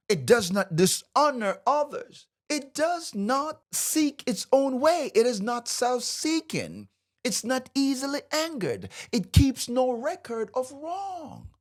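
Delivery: high-pitched, impassioned preaching; worked-up teacher driving each point home; fired up. Real, not acted. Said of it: "I get fired up about this so much".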